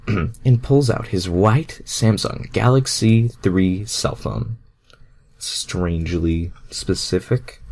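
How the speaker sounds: plain reading voice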